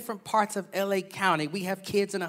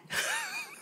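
real laugh; 9 dB quieter than usual. Real, not acted. Laughing at own joke.

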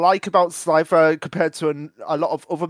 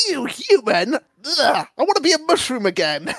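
higher, mock-disgusted monster whine; disgusted Mucus; 7 semitones higher than usual. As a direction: play a creature grossed out by being human. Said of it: "Mucus is just like".

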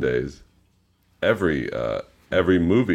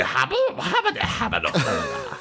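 high silly babble, slowing down; squeaky cartoonish gibberish; goofy nonsense voice; 11 semitones higher, slower than usual. Mock Adam Sandler gibberish voice.

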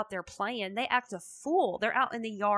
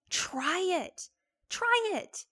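urging; 10 semitones higher, slower than usual. Real, not acted. Urging listeners to try.

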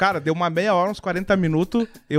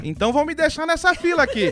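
much higher mock yell of the old lady; 11 semitones higher than usual. Old lady shouting in the queue at the clerk, high mock yell. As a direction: yell it as the furious old customer. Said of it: "E a véia começou a gritar".

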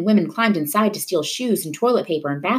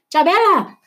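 much higher, loud call; crying out; 12 semitones higher, 5 dB louder than usual. A narrator's reading. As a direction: call her back as she runs. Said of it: "her grandfather cried".

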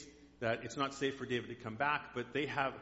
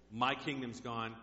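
usual speaking voice

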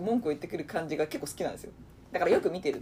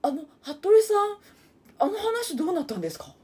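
lips pursed; 11 semitones higher than usual. Retold or imitated. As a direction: pout and whine the question sweetly. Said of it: "文句を言うときはちゃんとちょっと口を尖らせて言うのよ。それを今真似したの".

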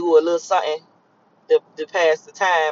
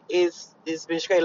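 ordinary delivery

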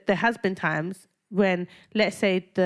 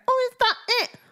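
much higher, laughing mock of Mike's question; 3 dB louder than usual. Friend retells her boyfriend's weak haggling, laughing in a high mock voice. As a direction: laugh while sending up a lame haggle. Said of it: "really disappointed that Mike was like".

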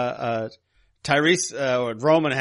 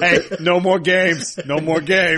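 mock gangster warning; 3 semitones higher, 3 dB louder, slower than usual. Mock villain line.

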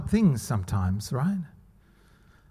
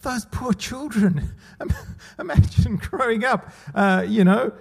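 wife's pity; 8 semitones higher than usual. Retold or imitated. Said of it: "she goes".

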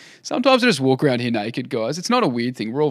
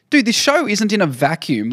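exasperated retort; 8 semitones higher than usual. Retold or imitated. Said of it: "I'm like".

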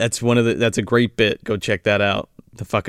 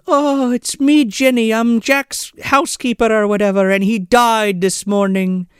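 mock housekeeper; 8 semitones higher than usual. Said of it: "she's like".

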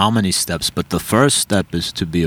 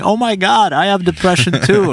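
mock gleeful squeal, much higher; mocking a lady who was happy about her depression, high and gleeful; 10 semitones higher than usual. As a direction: imitate her excited glee, voice jumping high. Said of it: "she gleefully just started saying".

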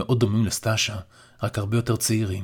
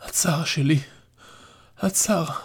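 put-on higher, tender old man; frail old father; 7 semitones higher than usual. A narrator's reading. Dying grandfather strokes his granddaughter's face, higher. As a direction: caress her with the words.

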